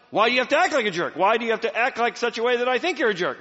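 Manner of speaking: loud, high, fast accusing question; exasperated accusation. Retold or imitated. Wife venting about her husband, loud accusing question. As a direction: fire the question at him. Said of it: "She goes".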